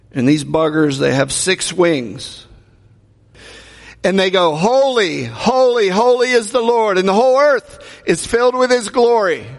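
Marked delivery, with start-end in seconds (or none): none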